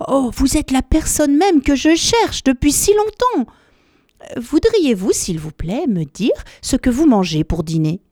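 high, breathless delight; excited elephant child. Acted. Elephant child thrilled to meet the crocodile, high and eager. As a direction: gush with joy at finding him.

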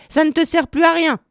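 high-pitched, cutting rebuke; scolding. A narrator's reading. Old witch telling Gretel to stop whining, scolding. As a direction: shut down the crying in a high voice.

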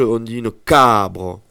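"cabre" with the first A held and stressed; cabre, stressed on the first syllable. Shown as a demonstration. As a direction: lean on the A of "cabre". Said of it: "J'ai accentué le A".

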